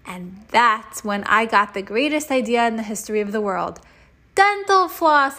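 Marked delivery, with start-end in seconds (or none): 4.4-5.4 slow, loud, high cry of discovery; excited shout. Acted. Boy shouting his brilliant idea to friends, loud and high. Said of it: "I shouted".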